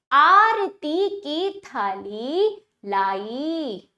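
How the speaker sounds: measured word-by-word आरती की थाली लाई; slow repeat reading. Shown as a demonstration. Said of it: "अब वाक्यों का उच्चारण करते हैं".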